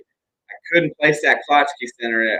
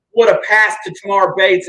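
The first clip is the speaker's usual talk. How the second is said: excited; 10 semitones higher, 9 dB louder than usual. Real, not acted. Dunk during live call.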